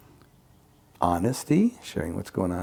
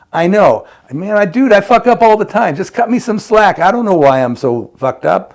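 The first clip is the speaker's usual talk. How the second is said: much higher and louder, anguished self-blame; self-pitying outburst; 10 semitones higher, 11 dB louder than usual. Retold or imitated. Acting out a harmful reply, a high, loud self-pitying wail. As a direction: wail it like you're falling apart.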